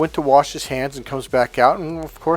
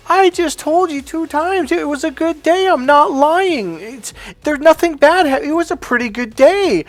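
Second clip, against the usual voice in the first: frustrated; 5 dB louder than usual. Retold or imitated. Husband insisting to his wife he isn't lying, frustrated. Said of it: "he's kinda frustrated".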